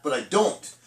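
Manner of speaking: normal talking voice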